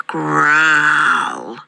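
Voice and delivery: storybook bear growl; growled word. A narrator's reading. Father Bear at his porridge bowl, big growl. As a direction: draw out the word as a bear's growl. Narrator acts out the bear's growl.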